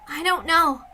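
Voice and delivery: ordinary delivery, nothing marked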